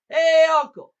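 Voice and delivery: long, high, full-voiced holler. A narrator's reading. Jack hollering to his uncle in Virginia, hollering. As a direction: call out to someone miles away. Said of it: "put his hand up to his mouth and hollers".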